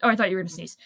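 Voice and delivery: normal talking voice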